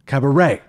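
"Cabaret" with "ray" punched; vindicated caba-RAY; stress on last syllable. Shown as a demonstration. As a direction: hit the final syllable of "Cabaret".

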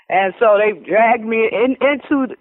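usual speaking voice

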